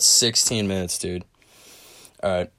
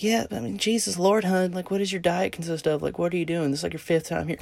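high, softer put-on woman's voice; mock manager; 7 semitones higher, 5 dB quieter than usual. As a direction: imitate a manager questioning a bathroom request. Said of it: "And she'd be like".

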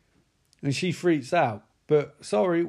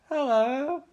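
put-on much higher, rising girly hello; mock girl hello; 9 semitones higher than usual. Nancy answers her dad, high girly hello. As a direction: chirp it back like a teen girl. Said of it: "She's like".

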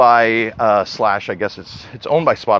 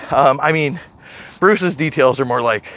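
normal talking voice

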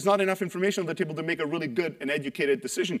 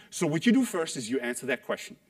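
ordinary talk

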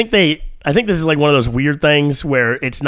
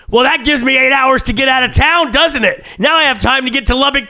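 high, louder, cocky gloat; smug reply; 10 semitones higher, 3 dB louder than usual. Retold or imitated. Answering the fake FBI text, a cocky high gloat. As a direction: taunt them like you've outsmarted them. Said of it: "followed by".

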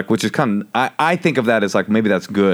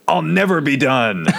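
higher, drawn-out mock battle cry; mock defiant cry; 5 semitones higher than usual. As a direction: stretch it out like a melodramatic vow. Retold defiant cry ending a fight.

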